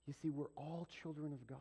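ordinary talk

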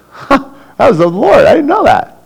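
high, loud, amazed huh; surprised realization. Acted. Believer realizing that God had spoken, high surprised voice.

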